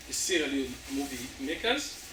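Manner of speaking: ordinary delivery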